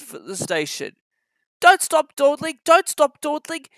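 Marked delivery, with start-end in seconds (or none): 1.6-3.7 grumbling. Acted. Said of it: "he grumbled".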